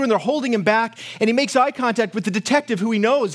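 ordinary talk

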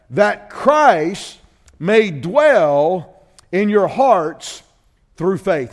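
"That Christ may dwell in your hearts" drawn out word by word; very slow, weighty reading. Shown as a demonstration. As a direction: lay each word down heavily. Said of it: "Watch that".

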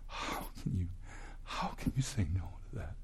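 hushed, breathy whisper, slowing down. Real, not acted. Preacher pleads with unbelievers in a hushed whisper.

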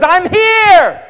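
high, loud proclamation; Jesus cries out at the temple; crying out. Retold or imitated. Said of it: "Jesus cries out and says".

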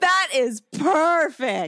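high and slow, savoring every word; emphatic delight. Real, not acted. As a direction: declare it slowly in sheer delight. Thrilled by the casting idea.

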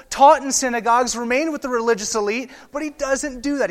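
high, loud pulpit fervor; full-force sermon peak, pressing the point home; fiery preaching. Acted. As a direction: preach it hard, voice climbing.

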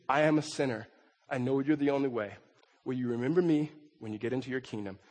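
soft, humble plea. Acted. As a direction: beg quietly for mercy. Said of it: "he looks at Jesus and says".

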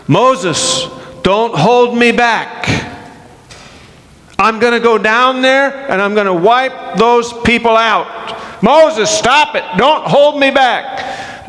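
a hint of an impatient God telling Moses to let go; pastor's mild take on God brushing off Moses; God demanding. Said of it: "God is saying to Moses".

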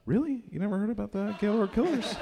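mock surprised street guy; incredulous. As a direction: gasp it in amused disbelief.

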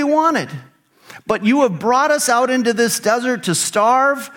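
high-pitched mock griping, easing down at the end; grumbling complaint. Hungry Israelites blaming Moses in the desert, grumbling. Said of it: "the whole community grumbled against".

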